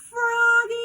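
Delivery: very high loud "Froggy" yell. Acted. Teacher calling Froggy, very high loud yell. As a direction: shout his name as loud as you can. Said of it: "Let me hear you yell it".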